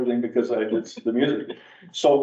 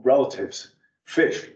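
usual speaking voice

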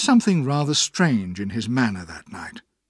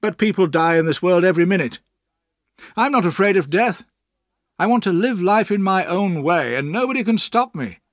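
high, loud, excited declaration; proud, heated outburst; careless defiance; 7 semitones higher, 5 dB louder than usual. Acted. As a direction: brush off death and assert your freedom. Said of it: "replied Steerforth carelessly".

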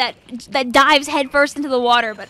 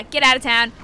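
ordinary talk, nothing marked

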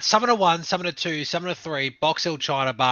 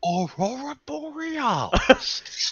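high, drawn-out incredulous exclamation; Steamed Hams skit impression; 12 semitones higher, much slower than usual. Riffing on Steamed Hams with a friend, mock-incredulous. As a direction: stretch it out in mock disbelief. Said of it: "steamed hams on with A S M R".

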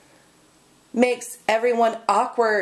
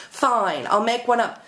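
ordinary talk

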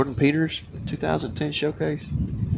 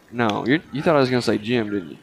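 ordinary talk

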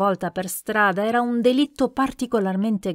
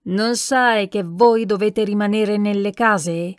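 usual speaking voice

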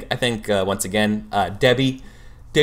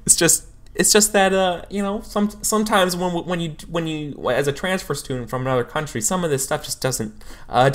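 higher, fumbling apology; apologetic counselor; 7 semitones higher than usual. Acted. Counselor letting the student down gently, higher and fumbling. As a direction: ease the bad news on the student. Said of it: "she's like".

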